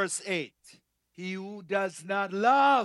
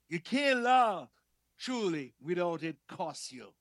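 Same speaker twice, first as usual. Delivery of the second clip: ordinary delivery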